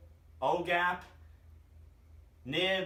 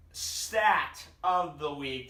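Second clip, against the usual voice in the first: slow, stretched-out segment title, a bit louder; segment announcer; 4 dB louder, much slower than usual. Real, not acted. Introducing his segment title.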